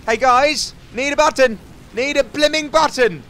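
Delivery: loud, high frustrated call; shouting to the team. Real, not acted. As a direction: yell for help across the map. Shouting to teammates for help.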